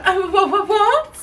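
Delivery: high, stammered mock disbelief; flustered mimicry. Shop worker reacting to racism accusation, mockingly flustered. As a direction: imitate someone caught out, squeaking the words.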